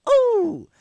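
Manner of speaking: high, loud ooh; mock crowd "ooh". Teacher reacting to his anti-joke, high mock ooh. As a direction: hoot like a burned audience.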